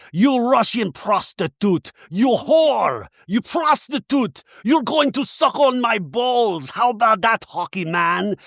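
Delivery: put-on hammy high Russian accent, slow; mock Russian villain taunt. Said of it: "do a Russian accent really fast".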